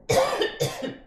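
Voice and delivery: sharp dry cough. Real, not acted. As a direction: cough hard to clear the throat. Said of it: "I don't know why I'm coughing".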